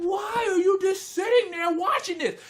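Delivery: high-pitched incredulous demand; hammed-up high exasperation; exasperated disbelief. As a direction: plead with the screen in disbelief. Re-enacts yelling at the screen.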